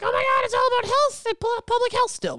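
very high, breathless mock panic; mocking panicked voice. S0 mocking people scared during lockdown, in a high squeal. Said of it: "We were like".

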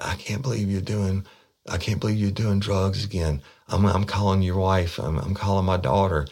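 high-pitched agitated mock scolding; retold freak-out. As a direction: imitate a frantic mother-in-law threatening to tell, without screaming. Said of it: "she started freaking out. She was screaming".

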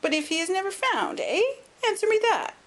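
put-on high-pitched, insistent old lord; querulous old man. A narrator's reading. Old lord pressing Overton about costs, querulous and high. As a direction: press the point in a high, crotchety voice. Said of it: "a querulous voice".

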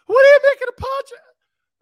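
very high, loud cartoonish squeal; squeaky Pinocchio voice. Shown as a demonstration. Host parodying West Coast radio, squeaky Pinocchio voice. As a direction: squeak it out like a puppet. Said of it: "I sound like I'm Pinocchio".

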